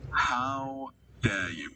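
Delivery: slow, stretched how dare you; slowly, each word stretched in pretend shock; mock outrage, drawn out.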